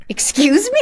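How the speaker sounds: high, incredulous excuse me; shocked disbelief. Retold or imitated. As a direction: react like you can't believe it. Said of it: "And I was like".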